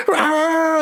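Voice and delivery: high, drawn-out "rah"; imitated dog bark. Man tells of dog jumping on mum, high mock bark. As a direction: yelp like a dog leaping up. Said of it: "dog's jumping on her leg going".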